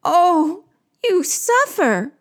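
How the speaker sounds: high sympathetic wail; echoed moan. Acted. Creatures echoing the queen's suffering, moaning high. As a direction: moan back, high and drawn. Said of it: "They moan back".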